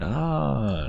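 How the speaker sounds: high, falling sigh of pretend wonder; mock awe. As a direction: play an impressed sightseer. Imitating passengers admiring the view.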